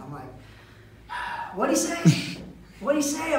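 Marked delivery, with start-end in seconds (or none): 1.5-3.4 high, loud repeated question; nagging question, pitched up; mock pestering passenger. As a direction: imitate someone who never hears. Same passenger asks again.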